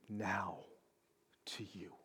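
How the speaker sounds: hushed, barely voiced; whisper. Real, not acted. Preacher whispering to the congregation, hushed. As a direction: drop to a secret whisper.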